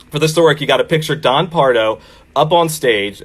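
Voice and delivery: ordinary talk, nothing marked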